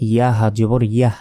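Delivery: final ইয়াহ closed with a breath of h; stop on small ha. Shown as a demonstration. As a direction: end ইয়াহ on h, not t. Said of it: "এভাবে বলতে হবে".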